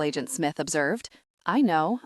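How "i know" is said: ordinary delivery, nothing marked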